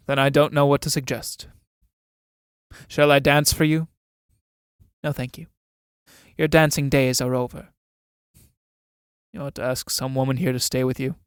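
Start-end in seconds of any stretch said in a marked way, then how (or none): none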